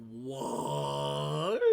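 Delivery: long drawn-out acted "what", swooping up at the end; incredulous. Reacting to stud claiming that's her girl, incredulous. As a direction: stretch the word out in disbelief. Acts out disbelief at stud's claim.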